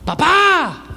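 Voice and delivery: high-pitched holler for dad; high, hammed-up kid calling out; imitated child's yell. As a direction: yell for dad like a demanding little boy. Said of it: "porque está gritando".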